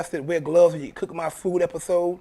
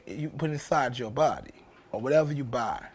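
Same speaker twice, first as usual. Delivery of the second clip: normal talking voice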